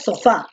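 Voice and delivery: sofá stressed on the final FÁ; stress on last syllable. Shown as a demonstration. Teacher repeating the correct word for the student, sofá stressed on FÁ. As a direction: land the voice on FÁ in so-FÁ. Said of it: "la fuerza de voz recae en la última".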